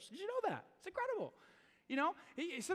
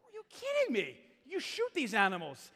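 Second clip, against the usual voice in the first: wide-eyed disbelief at the moose hunter; mock amazement; 3 semitones higher than usual. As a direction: play up the disbelief.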